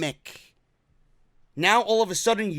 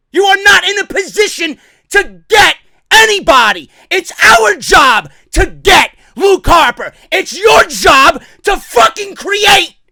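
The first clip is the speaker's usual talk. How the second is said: furious shouting; 6 semitones higher, 6 dB louder than usual. Real, not acted. Fan telling the promoter whose job is whose, furious shouting. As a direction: shout it at them, hammering each phrase. Irate rant at wrestling promoter.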